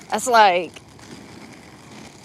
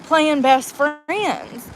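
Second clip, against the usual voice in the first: slowed sarcasm; much slower than usual. Real, not acted. Drawn-out sarcastic aside.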